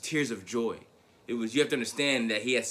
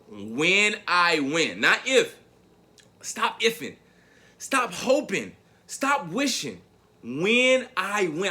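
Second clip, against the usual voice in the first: insistent self; 6 semitones higher, 5 dB louder, much slower than usual. Retold or imitated. Telling family before the race.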